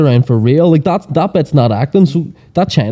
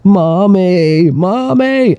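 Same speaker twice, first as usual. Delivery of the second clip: mock crying; 6 semitones higher, much slower than usual. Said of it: "then he starts crying, going".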